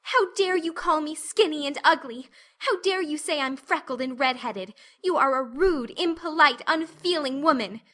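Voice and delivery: put-on a girl hurling insults back at a rude woman; furious child. A narrator's reading.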